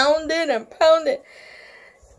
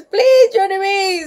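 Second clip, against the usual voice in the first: high, loud pleading; acted fed-up grandpa; exasperated plea; 6 semitones higher, 4 dB louder than usual. A narrator's reading. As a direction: beg over the noise. Grandpa begs her to stop screeching.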